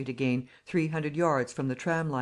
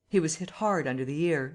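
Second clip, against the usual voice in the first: ordinary delivery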